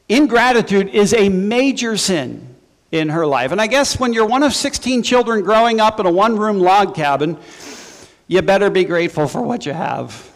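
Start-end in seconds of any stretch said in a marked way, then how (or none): none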